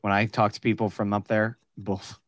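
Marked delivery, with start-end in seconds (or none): none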